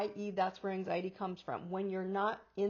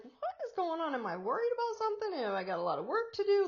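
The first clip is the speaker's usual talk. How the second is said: higher, rushed, fretting questions; quick anxious self-questioning; worried inner voice; 8 semitones higher, faster than usual. Retold or imitated. Asking herself in a rushed, higher worried voice. Retelling her own worried thoughts.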